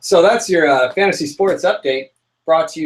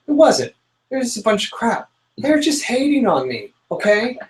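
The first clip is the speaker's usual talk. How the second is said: indignant; 8 semitones higher than usual. Real, not acted. Indignant rant about bad call.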